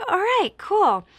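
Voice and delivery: high, slow, airy "all right, cool"; mock casual shrug. Hearing she should start in the nursery, mock-breezy. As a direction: brush it off with exaggerated nonchalance. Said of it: "I'm like".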